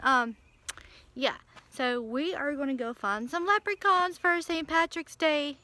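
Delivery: normal talking voice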